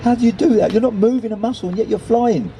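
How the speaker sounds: high-pitched, wondering bird voice; amazed questioning. A narrator's reading. Nelson asks the gull how he flies, high and amazed.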